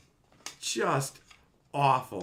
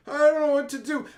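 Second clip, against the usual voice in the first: high, fast whine; whiny helpless voice; 12 semitones higher, 6 dB louder, faster than usual. Retold or imitated. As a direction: whimper it like a useless hero. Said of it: "the guys in the shows just gonna go".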